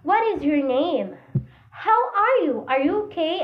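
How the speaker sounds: questions with lifted pitch, highest on "How are you"; rising intonation demo. Shown as a demonstration. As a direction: ask "What is your name", then swing "How are you" up high. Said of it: "First is rising".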